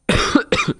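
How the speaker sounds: sudden harsh choking cough; coughing fit. Real, not acted. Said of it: "Sorry, I'm choking up".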